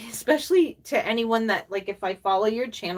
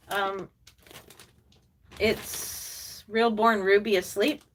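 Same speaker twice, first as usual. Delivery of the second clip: ordinary talk, nothing marked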